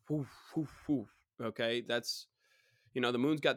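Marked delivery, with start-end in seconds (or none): 0.1-1.1 repeated short whoof noises imitating waves; wave whooshes. Shown as a demonstration. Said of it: "When you think waves, it's just".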